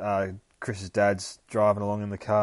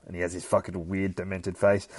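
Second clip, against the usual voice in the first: ordinary delivery, nothing marked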